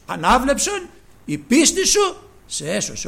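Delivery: loud, high commanding blessing; solemn proclamation. Retold or imitated. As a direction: declare the healing with authority. The Lord heals Bartimaeus. (επίσημη δυνατή προσταγή)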